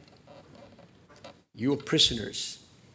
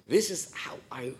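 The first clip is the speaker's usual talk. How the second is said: breathy whisper of a private vow; 2 semitones higher, 3 dB quieter than usual. Acted. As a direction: whisper it as a personal resolution.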